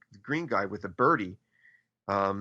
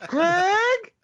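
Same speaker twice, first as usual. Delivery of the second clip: mom voice yelling much higher and louder; 7 dB louder than usual. Retold or imitated. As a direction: shout his name like a fed-up mom. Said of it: "that's just my mom voice".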